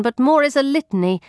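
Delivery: usual speaking voice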